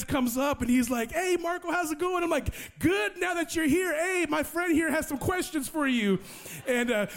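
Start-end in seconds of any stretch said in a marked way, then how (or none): 1.1-2.2 high, breezy greeting; cheery friend. Retold or imitated. Friend comes up to greet a struggling evangelist, cheery. 2.8-6.2 high, relieved retold welcome; eager relief. Evangelist hands hard questions to his friend, relieved.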